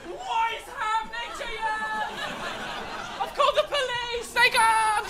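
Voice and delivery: very high, hammed-up panicky voice; comic high-pitched cry of alarm; panicked shrieking. Acting panicked bystander calling to screaming foxes.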